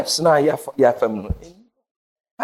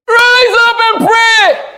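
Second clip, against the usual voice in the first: high, loud, slow command; preacher voicing God telling them to rise; commanding shout; 5 dB louder, slower than usual. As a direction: thunder it like God calling.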